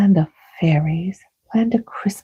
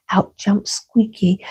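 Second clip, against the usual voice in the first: usual speaking voice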